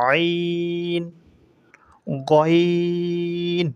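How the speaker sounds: আইন squeezed in the throat, গইন drawn out slowly; আইন আর গইন, ধীরে গলা থেকে; Arabic ain and ghain. Shown as a demonstration. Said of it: "এভাবে বলতে হবে".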